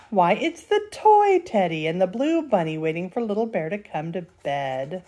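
plain reading voice

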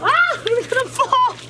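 high, slow, alarmed cry; scared while slipping. Real, not acted. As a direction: afraid of falling. Slipping on the slope.

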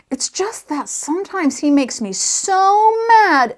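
loud, high swell on so mad; slow, exasperated acted outburst; anger rushing back. A narrator's reading. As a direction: let the anger build to a drawn-out peak. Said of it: "his anger came rushing back".

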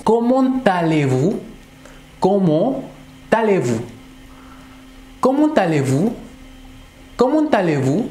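Comment allez-vous asked with a high rising tune; polite question. Shown as a demonstration. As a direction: lift the end like a polite question. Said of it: "nosotros decimos".

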